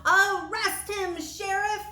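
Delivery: high, loud slow shout; angry shout. Acted. Harvey Bender shouts to the sheriff to arrest the old man, high and loud. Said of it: "shouted Harvey Bender".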